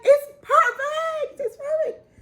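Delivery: high, slow, crying it's perfect; overwhelmed crying with a laugh; tearful joy. Real, not acted. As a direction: sob it out through happy tears.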